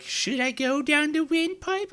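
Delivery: high squeaky voice for the food; voicing her dinner. Her spicy dinner plotting to choke her, high squeaky voice. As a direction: play the dinner as a sneaky little critter. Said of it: "it was like".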